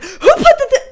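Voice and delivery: high, loud flare-up cut short; mock angry outburst. Host showing the audience how they react at home, comically mad. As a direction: blow up over a trifle, playfully. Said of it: "think of me as mostly mad".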